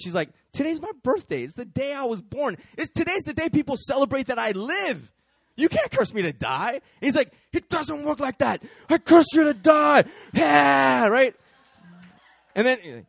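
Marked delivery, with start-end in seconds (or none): 0.5-6.7 high, triumphant acted retort; gleeful defiance. Birthday girl defying a death curse, high and gleeful. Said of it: "she laughs because it's actually her birthday". 7.5-11.4 high, loud hammed-up rage; frantic cursing. Warlock insisting to laughing woman, hammed-up high shouting.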